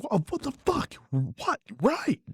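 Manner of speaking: high-pitched frazzled snap, loud at the end; dad losing it at the nagging kid; exasperated outburst. Retold or imitated. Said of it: "turn around and be like".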